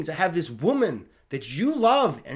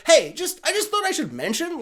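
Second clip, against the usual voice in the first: lightly voiced casual phone caller, pitched up; Kevin Feige; 9 semitones higher than usual. Studio boss phoning the writer, lightly acted casual caller. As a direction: breezily broach an awkward subject. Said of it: "Kevin Feige's like".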